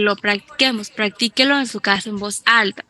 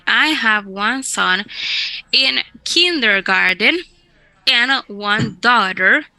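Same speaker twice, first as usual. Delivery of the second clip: very slow, clear model reading; slow, deliberate model sentence; slower than usual. Shown as a demonstration. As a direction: read it out slowly for the class.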